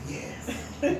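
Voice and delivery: breathy unvoiced yes; whispered yes. A narrator's reading. Child whispering to the boss on the phone. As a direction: keep it hushed like a hiding child. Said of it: "And he said".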